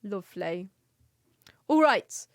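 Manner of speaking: normal talking voice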